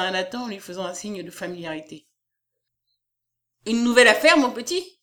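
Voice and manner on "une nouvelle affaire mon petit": loud outburst from the publisher; much louder opening, indignant query; exclaiming. A narrator's reading. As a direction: exclaim it at the newcomer. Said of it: "s'écria Doria".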